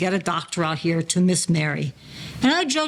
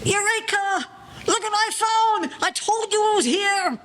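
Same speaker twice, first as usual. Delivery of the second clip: much higher, jubilant shout of triumph; triumphant discovery; 12 semitones higher than usual. Acted. Gloating to his partners that the thing was here all along, excited. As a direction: crow it out like you just struck gold. Finds the lost item, triumphant.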